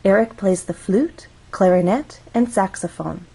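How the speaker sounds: up on "flute", up on "clarinet", down on "saxophone"; list intonation. Shown as a demonstration. Teacher reading the sentence for listeners, list intonation. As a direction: raise "flute," and "clarinet," then close with falling "saxophone". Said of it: "Listen to how I read that sentence".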